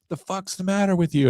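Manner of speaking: mock indignant, pitched high; retold driver's outburst. Retold angry driver confronts him.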